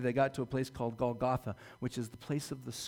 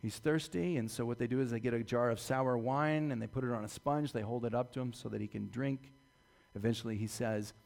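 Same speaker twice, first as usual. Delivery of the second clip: normal talking voice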